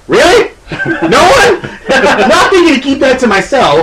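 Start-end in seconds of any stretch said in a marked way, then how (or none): 0.1-1.6 incredulous. Retold or imitated. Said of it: "me being me goes".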